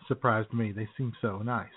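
normal talking voice

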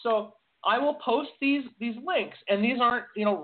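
high excited insistence on the news links. Real, not acted. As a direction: push the point hard, voice high. Excited emphatic point.

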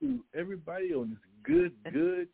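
in front of an audience. Real, not acted. Guest's playful on-air greeting.

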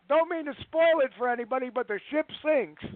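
a high, playful call across a dark theater; mock yell out. Calling out a spoiler to a darkened movie theater, mock yell. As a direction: toss the spoiler out high to the whole room. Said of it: "yell out".

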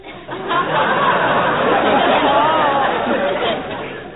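long, high, rising laugh; high drawn-out chuckle; knowing laugh. Acted. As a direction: laugh at being caught out. Catching Kingfish out on money.

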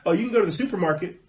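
high, loud, quick fake delight; mock offer. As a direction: rush it out like a sarcastic treat. Mocking officials dangling freedoms.

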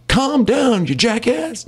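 high-pitched mock movie-cop yell; mock scream voice. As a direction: squeal it like an overexcited movie cop. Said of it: "Like, it's my scream voice".

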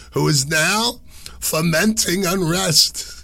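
high-pitched mock-reading in an old Italian lady accent. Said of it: "that was my old Italian lady accent".

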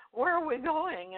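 high, anxious question; retold scared cry of where are we going; frightened question. Retold worried question at cliff.

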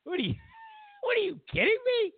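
incredulous laughing outburst. Real, not acted. Mocking the shaming plan with high laughing disbelief.

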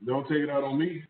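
ordinary talk, nothing marked

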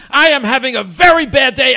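high, full-force exasperated yell; screaming. Retold or imitated. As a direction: bellow it as an angry complaint. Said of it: "he starts screaming at me".

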